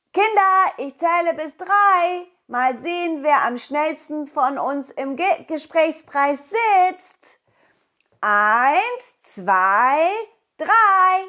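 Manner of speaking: put-on loud high call to the children. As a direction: call the kids over, counting loud. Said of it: "dann rief ich".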